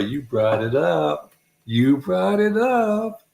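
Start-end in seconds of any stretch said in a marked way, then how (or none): 1.7-3.2 high, drawn-out teasing repeat; teasing singsong. Real, not acted. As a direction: stretch it out, rising high. Teasing repeat of blame to Laura.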